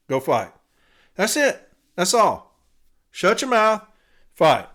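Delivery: loud, high-pitched exasperated orders; fed-up rant. Real, not acted. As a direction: cut off the complaints with forceful commands. Rant against complaining, barked orders.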